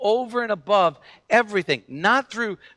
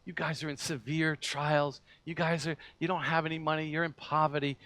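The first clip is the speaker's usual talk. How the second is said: gentle reassurance; 2 semitones lower, 8 dB quieter than usual. Retold or imitated. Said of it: "Paul probably said to him".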